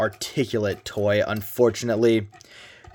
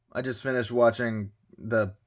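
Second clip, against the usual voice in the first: usual speaking voice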